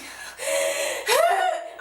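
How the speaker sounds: very high laugh breaking into a sob; squeal-laugh near tears; high crying laugh. Real, not acted. Fan laughing and crying at her idols, high squeal.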